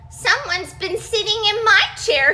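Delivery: high, loud, upset little cub; crying baby bear. Acted. Baby Bear crying at his broken chair. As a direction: wail it like a tiny bear. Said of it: "he cried".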